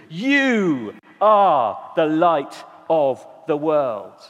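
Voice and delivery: YOU drawn out slowly and leaned on; stress on YOU. Shown as a demonstration. Preacher pressing "You" on the congregation, slow and weighty. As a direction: hit the word you, then let the line fall slowly. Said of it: "the emphasis is on the word you".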